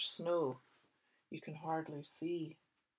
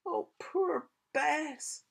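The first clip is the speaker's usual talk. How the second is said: higher, very slow lament; sad child; 9 semitones higher, 4 dB louder, much slower than usual. Acted. Sam worries for Bess, slow and sad. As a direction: sigh it sadly. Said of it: "said Sam".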